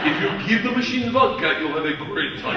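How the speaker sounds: put-on Russian-accented gangster introduction; Igor. Gangster introducing his friend to new gangsters, Russian-accented voice. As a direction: present him gravely in a Russian accent. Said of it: "he pulls me aside".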